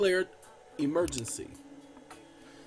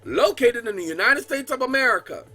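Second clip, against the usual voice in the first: loud, formal reading of a presidential decree; proclamation read; 5 semitones higher, 7 dB louder than usual. Acted. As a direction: declaim it like an official proclamation.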